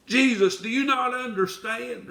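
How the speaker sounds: high, loud accusing cry; spontaneous outburst. Acted. Believer cries out to Jesus, high and loud. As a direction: fling it at Jesus in frustration. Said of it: "the little spontaneous outbursts".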